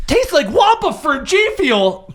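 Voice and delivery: slow, high, loud ad-read voice; hammed-up sponsor plug. Player jokingly plugging the sponsor drink, hammed-up ad voice. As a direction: sell it like a cheesy commercial.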